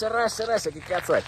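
high-pitched imitation of an excited shouter; mock crazed yell. Quoting the madman shouting at a car, mock yell. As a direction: mimic a madman yelling, without real volume. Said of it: "senti sto pazzoide qua che urla".

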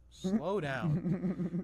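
a cop barking a firm order; firm cop warning. Retold or imitated. Speeder retelling the cop sending him home, firm loud warning.

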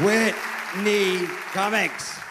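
milk the name for applause; drawn-out name call. Real, not acted. Host milking applause, slow stretched call.

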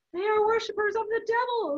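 high, strained protest; earnest objection. A narrator's reading. Abbot defends himself to the monk, earnest objection. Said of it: "Hubert objected earnestly".

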